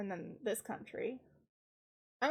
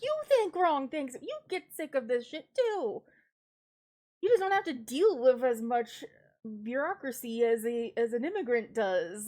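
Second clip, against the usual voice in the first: raised, higher-pitched, exasperated comeback; heated mock argument, pushed louder; indignant retort; 3 semitones higher, 9 dB louder than usual. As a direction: fire back loudly at your critics. Retorting to French forum critics.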